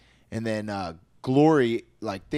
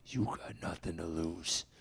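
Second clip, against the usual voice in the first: lower, slower, menacing resolve; low, slow self pep talk; 5 semitones lower, 8 dB quieter, slower than usual. Retold or imitated. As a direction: steel yourself quietly. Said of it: "I'm like".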